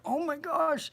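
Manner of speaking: hammed-up high shock; stunned exclamation. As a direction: mimic a friend's awestruck gasp of words. Imitates friend's stunned reaction, jaw dropped.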